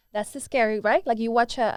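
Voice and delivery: ordinary delivery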